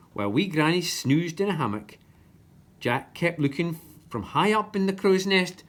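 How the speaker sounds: usual speaking voice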